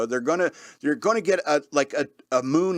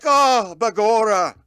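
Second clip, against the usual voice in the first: hammed-up stage Irish accent, higher and louder; 4 semitones higher, 5 dB louder, slower than usual. Player showing off his Irish accent to the table, hammy stage Irish. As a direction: ham up a stage Irish brogue. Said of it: "I can do me Irish accent now".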